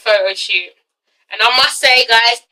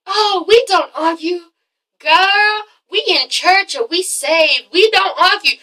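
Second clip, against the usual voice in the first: mock prim wife; 5 semitones higher, slower than usual. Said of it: "she makes everything seem like".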